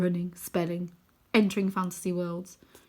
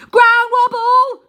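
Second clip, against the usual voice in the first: high, loud, stretched-out cry; delighted shout; 17 dB louder, much slower than usual. A narrator's reading. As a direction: yell it with glee. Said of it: "shouts Wynne, delighted".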